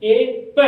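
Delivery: loud split of ए प for reading; split sounds. Shown as a demonstration. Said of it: "हमने sound किया ए".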